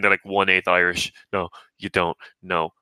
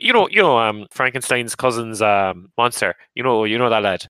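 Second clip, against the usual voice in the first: Limerick local; 3 dB louder than usual. Retold or imitated. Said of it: "you're like".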